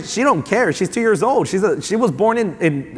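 ordinary talk

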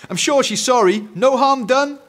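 put-on high, loud boy's excuse; smooth it over in a high, loud voice; Eric wheedling. Eric tries to fool Mum, wheedling.

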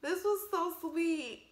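moved to tears, stretching the words out; tearfully moved. Real, not acted. Viewer moved by the sweet movie reunion, tearfully moved. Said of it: "No, I'm crying".

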